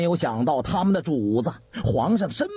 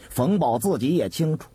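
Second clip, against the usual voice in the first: ordinary delivery, nothing marked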